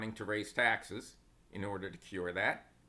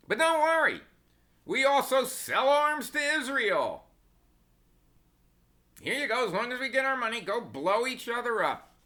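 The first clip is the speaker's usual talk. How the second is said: high, loud sarcastic sales pitch; mock cheery reassurance; 13 semitones higher, 8 dB louder than usual. Selling arms to both sides, mock cheery high shout.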